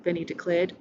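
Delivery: ordinary delivery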